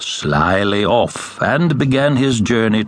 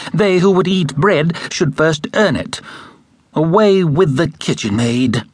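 higher, scornful jeer; sneering stepsister; 8 semitones higher than usual. A narrator's reading. Stepsisters mocking the poor girl, lightly sneering. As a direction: look down your nose at her.

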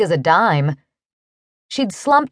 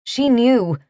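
ordinary delivery, nothing marked

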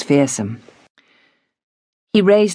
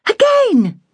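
much higher, blazing disbelief; indignant girl; 4 dB louder than usual. A narrator's reading. Girl told to stay off work, indignant. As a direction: flare up in outrage. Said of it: "Polly's eyes blazed".

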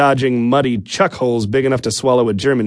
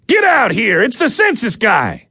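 much higher impatient yell; shouting; 12 semitones higher than usual. Acted. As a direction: holler into the house. Husband yells to wife inside.

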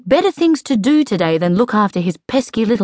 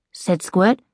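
ordinary delivery, nothing marked

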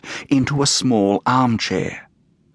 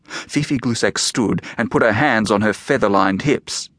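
ordinary delivery

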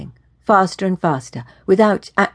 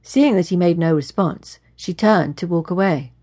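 usual speaking voice

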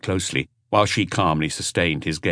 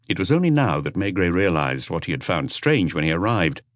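usual speaking voice

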